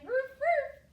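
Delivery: high, loud imitated woofs; two sharp dog barks; puppy bark imitation. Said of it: "He kept saying".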